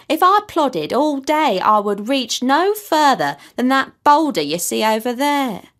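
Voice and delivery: an ancient tortoise sighing over his heavy shell; weary old tortoise pleading. A narrator's reading. Tortoise laments his age.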